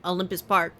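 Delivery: ordinary talk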